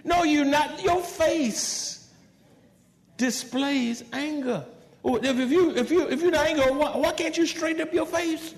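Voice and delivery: high, loud mock scolding; rising exasperation, pushed high and hard; exasperated scolding. Preacher confronting someone who denies anger, loud exasperated scolding.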